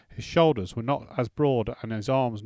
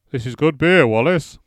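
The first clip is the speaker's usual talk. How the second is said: loud, higher cheerful father; hearty father voice; 4 semitones higher, 11 dB louder than usual. Acted. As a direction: raise a toast to the host. Father praising the ale.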